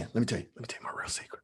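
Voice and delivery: fast, breathy secret whispered to the audience; conspiratorial whisper. Pretend. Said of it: "come here, lean in".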